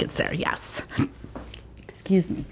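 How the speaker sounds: soft whispered aside; whispering. Real, not acted. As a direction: murmur it under breath.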